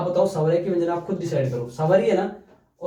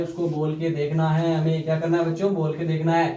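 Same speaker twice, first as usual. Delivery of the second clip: ordinary delivery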